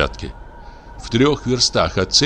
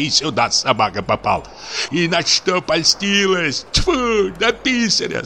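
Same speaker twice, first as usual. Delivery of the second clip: a lightly voiced, higher ranting husband; a jealous sexton railing at his wife in a raised voice; raving sexton husband; 7 semitones higher than usual. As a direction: rant at the wife, half crying, half furious. Husband's long tirade voiced.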